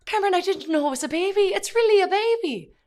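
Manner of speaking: high, loud mocking girl voice, stunned; shocked awe. Mocking stunned girls after the pro-life presentation, high and loud. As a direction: parody their wide-eyed shock. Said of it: "just in shock".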